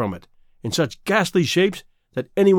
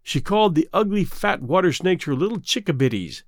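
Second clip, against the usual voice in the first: plain reading voice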